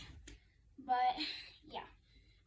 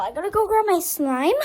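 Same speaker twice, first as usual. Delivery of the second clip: ordinary talk, nothing marked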